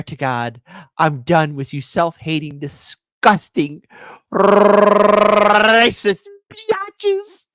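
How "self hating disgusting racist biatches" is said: very slow, loud stretched-out insult; drawn-out insult. Acted. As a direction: drag out every syllable.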